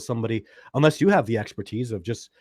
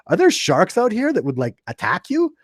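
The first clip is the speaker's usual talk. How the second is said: high, loud alarmed question; excited question; 9 semitones higher, 9 dB louder than usual. Retold or imitated. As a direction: ask it wide-eyed and loud. Retelling asking rangers about sharks.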